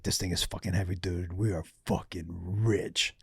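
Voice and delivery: very low, soft retold excitement, slowing at the end; acted-out awe, kept low and quiet; hushed excitement. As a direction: murmur it like a thrilled conspirator. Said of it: "they were so excited".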